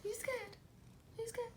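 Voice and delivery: sing-song spooky tease; playful high question. Real, not acted. Parent teasing child about being scared.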